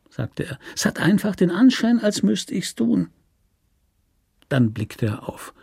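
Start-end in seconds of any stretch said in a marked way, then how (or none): 0.8-3.1 pained repetition. Acted. Repeats louder, pained.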